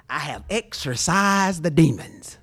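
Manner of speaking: put-on slow, eerie little old lady voice; Poltergeist lady quote. Mimicking the film's medium to the congregation, slow and eerie. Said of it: "little fat, stoopy-like lady comes out".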